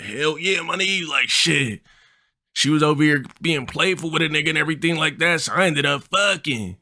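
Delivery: high bragging homie impression; friend's voice. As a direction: boast in his high voice. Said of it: "Yeah, that's how he talked".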